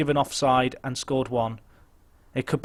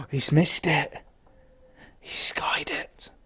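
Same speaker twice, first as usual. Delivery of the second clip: whispered, breathless relief; hushed disbelief; 4 dB quieter, slower than usual. Real, not acted. Player watching a missed chance, whispering in relief.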